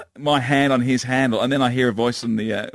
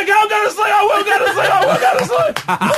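very high, loud mock-child wail; panicked toddler; 4 dB louder than usual. Son seeing the van, panicked shrieking surrender. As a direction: imitate a terrified small boy surrendering.

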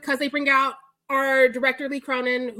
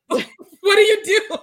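loud, higher, laughing through it; laughing quote; 6 semitones higher, 8 dB louder than usual. Retold or imitated. Imagining melting after Bruce Campbell yells, laughing hard. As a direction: crack up mid-question.

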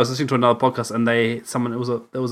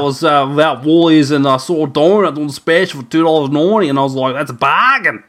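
louder, hammed-up broad Australian; country Aussie accent; 5 dB louder than usual. Joining in imitating country people, broad Aussie accent. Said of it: "people talking like that".

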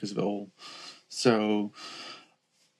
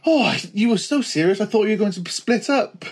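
high-pitched, gushing mock relief; massive relief; 8 semitones higher than usual. Mum reacting to the couple's news, hugely relieved. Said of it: "she just did a massive sigh of relief".

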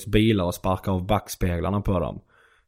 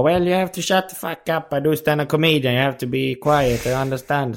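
higher-pitched imitated telling-off in English; mock scolding; 4 semitones higher than usual. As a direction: lecture hecklers, not shouting. Retold scolding of American hecklers.

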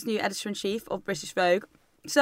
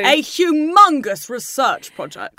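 mock grandiose; 5 semitones higher, 8 dB louder, much slower than usual. Real, not acted. Mocking the study's grandness.

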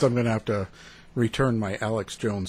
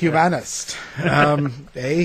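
hammed-up Canadian "Humanist", eh; Canadian accent; 4 dB louder, slower than usual. Shown as a demonstration. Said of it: "that's my Canadian accent".

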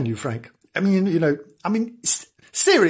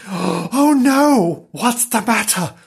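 alarmed; 7 semitones higher, 3 dB louder, slower than usual. Acted. Frightened animals in story.

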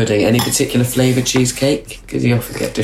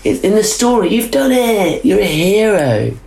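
high, bright storybook cheer; excited praise; 10 semitones higher, slower than usual. Acted. Host to child guest, a high excited cheer. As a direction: hype the child up like a hero.

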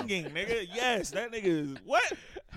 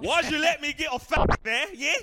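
loud, higher-pitched London accent; 6 semitones higher, 8 dB louder than usual. Shown as a demonstration. Friend shows off his London accent to the guys, loud London accent. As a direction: put on a punchy London accent. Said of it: "that's my London accent".

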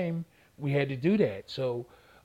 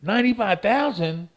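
high, louder sputtering outrage; outraged cussing; 7 semitones higher, 4 dB louder than usual. Retold or imitated. Boxer retells cussing over the phone, outraged. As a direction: choke on the number in fury. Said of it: "I started cussing".

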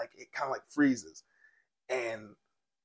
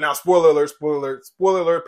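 announced warning; 2 semitones higher, 9 dB louder than usual. Real, not acted. Warning viewers of spoilers.

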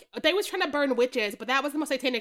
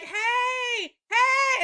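high shouted "hey"; calling out; 9 semitones higher, 3 dB louder than usual. Retold or imitated. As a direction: call across the field to get her attention. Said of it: "the girlfriend was like".

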